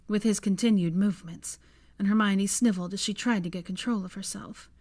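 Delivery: ordinary delivery, nothing marked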